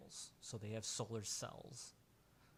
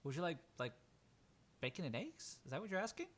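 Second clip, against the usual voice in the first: lightly voiced woman, higher questioning lilt; friendly Jenny; 6 semitones higher, faster than usual. Woman checking the bather's order, higher friendly voice. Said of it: "She just goes".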